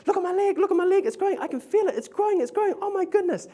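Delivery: very high excited squeal; imitating the astonished lady with a shrill rise; amazed woman. Preacher voicing the amazed woman watching her leg grow.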